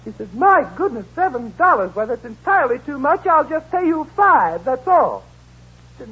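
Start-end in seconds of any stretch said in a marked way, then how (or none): 0.3-5.2 raised prim lady voice. Acted. Customer to porter, a raised fussy lady voice. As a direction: play the fussy rich lady. Woman haggling in a joke.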